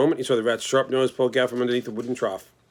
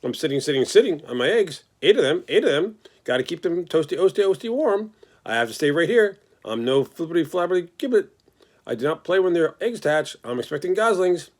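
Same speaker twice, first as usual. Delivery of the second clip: higher, chattering goose with stuttered repeats; fussy goose; 6 semitones higher than usual. Acted. The goose tells the pig she must sit on her eggs, fussy and high. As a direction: cluck it out like a busy mother goose.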